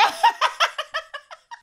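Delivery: high-pitched burst of real laughter. Real, not acted. Storyteller cracking up over a friend's costume, high laugh. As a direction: cackle helplessly.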